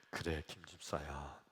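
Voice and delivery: low, soft divine voice; Jesus. Acted. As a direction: answer gently from above, low and quiet. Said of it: "예수님이 하시는 말씀이". (낮고 부드러운 목소리로)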